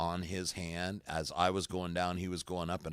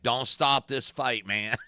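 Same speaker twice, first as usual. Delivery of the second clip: retold yell; 5 semitones higher, 8 dB louder, slower than usual. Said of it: "I was like".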